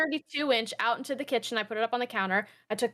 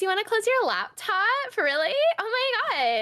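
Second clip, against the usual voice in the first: put-on valley girl; 11 semitones higher, 4 dB louder than usual. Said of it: "Valley Girl voice".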